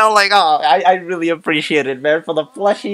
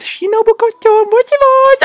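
very high, loud cutesy falsetto; playful squeal; 4 dB louder than usual. Acted. Fan naming Shinobu Kocho to open, playful squeal. Squeals character catchphrase in delight.

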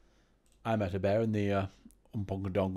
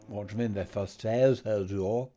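usual speaking voice

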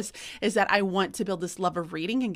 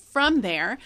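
ordinary delivery

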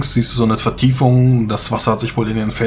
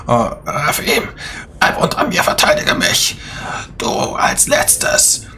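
harsh whispered orders; raspy necromancer whisper; 3 semitones higher, slower than usual. Acted. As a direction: hiss the commands.

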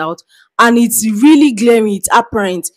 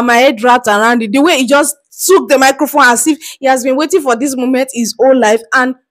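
ordinary talk, nothing marked

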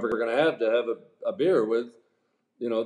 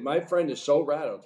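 usual speaking voice

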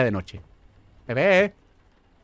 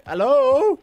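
calling out; 11 semitones higher, 5 dB louder than usual. Real, not acted. Calling out into dark house.

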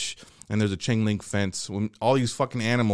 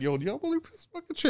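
sneaky aside; 14 semitones higher, 7 dB quieter, faster than usual. Retold or imitated. Teen self quoted whispering aside.

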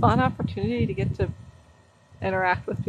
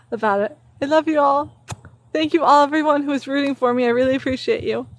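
ordinary delivery

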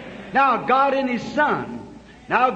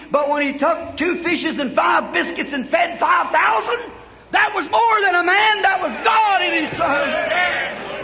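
preaching at full force; 5 semitones higher than usual. Acted. Preacher proclaiming the miracle to the congregation, rising shout. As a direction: build to a shouting peak.

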